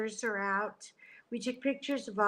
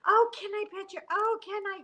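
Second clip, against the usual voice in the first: high, excited strangers asking to pet; eager stranger; 10 semitones higher, faster than usual. Retold or imitated. Strangers in Sleepy Hollow begging to pet the dogs, high and eager. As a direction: chirp it up like an excited dog lover. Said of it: "people just stopping and".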